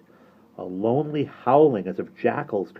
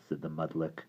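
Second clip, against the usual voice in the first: ordinary delivery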